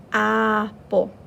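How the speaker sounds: "Apple." with a loud, drawn-out short A at the start; stressed short A. Shown as a demonstration. Teacher modeling the short A for children, stressed and clear. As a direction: stress the opening A of "Apple." for young readers. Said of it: "if you hear the A sound at the beginning".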